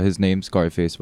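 normal talking voice

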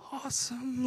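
a barely-there, restrained awesome; holding back excitement. Retold or imitated. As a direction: play it cool while bursting inside. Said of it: "I'm trying not to freak out".

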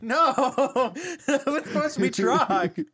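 high, laughing no; laughing protest. Real, not acted. Player laughing as the word game goes wrong.

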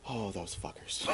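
barely voiced, low curse; quiet mock fury. Columbus spotting the deserters, quiet stifled mock fury. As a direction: curse under your breath, very low. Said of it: "Quietly screams into his hell".

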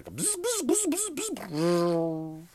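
very high buzzing noises; mother changing a nappy at pit-crew speed; power tool buzz. Retold or imitated. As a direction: zip through it like a pit-crew air gun. Imitates a pit crew's tools.

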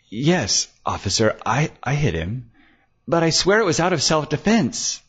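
delicate woman's voice. A narrator's reading. Claire confesses to the detective with a delicate smile, demure. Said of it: "smiled delicately".

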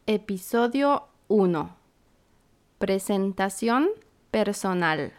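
ordinary delivery

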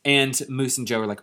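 ordinary delivery

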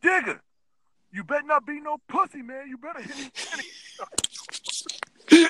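high, loud mock taunt; drunk dare. Friend quoting him in Vegas, loud high taunting dare. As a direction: shout it up high like a drunk daring a fight. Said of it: "You said".

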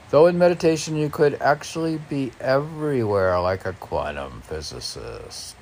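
loud, lifted recital; declaiming a poem line; poem reading. Acted. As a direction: read it out as verse. Reads poem lines aloud.

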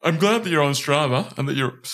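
talking through a yawn; words stretched by a yawn. Real, not acted. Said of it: "I keep yawning in your bloody ears".

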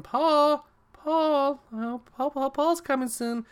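a girlfriend on a raft calling her boyfriend, high mock yells. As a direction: call for Paul in a high, hammed-up voice. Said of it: "she's yelling".

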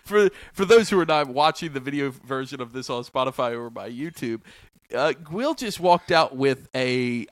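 laughing while describing. Real, not acted.

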